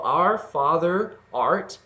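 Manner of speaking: slow, stretched opening words; drawn-out lyric demo. Shown as a demonstration. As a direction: draw out Our Father art. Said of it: "those all make the same, kind of sound".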